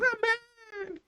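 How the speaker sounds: very high squeaky mock amen; wife's echoing amen. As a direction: chime in like a devoted echo. Wife echoing husband's vision.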